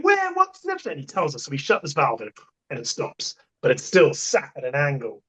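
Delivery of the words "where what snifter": much higher, flustered questions; panicked confusion. Retold or imitated. Crew asks the contractor where the valve is, high flustered panic. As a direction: yelp it out, totally lost. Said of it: "And we're going".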